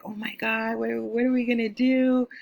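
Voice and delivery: high panicky retelling of fear; nervous panic. Retold or imitated. Panicking with sister over impounded car, high nervous voice. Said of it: "I was, like, so nervous".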